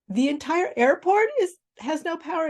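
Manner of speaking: high, incredulous repeat of his words; shocked disbelief. Retold or imitated. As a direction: echo the news in stunned disbelief. Disbelieving echo of the man.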